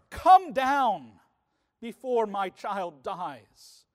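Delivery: loud pressing demand, then slowing on the last words; urgent imperative. Retold or imitated. Desperate father demanding Jesus come before his son dies, loud then slowing. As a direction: push the command, then drag it out. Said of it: "Then he has an imperative".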